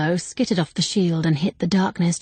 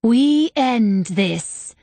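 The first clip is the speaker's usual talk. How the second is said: slow, menacing threat, a little higher and louder; malice; 4 semitones higher, 3 dB louder, much slower than usual. Acted. Villain Malin to the narrator before a final blow, slow and menacing. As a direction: draw out each word like a death sentence. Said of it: "her face twisted and ugly with malice".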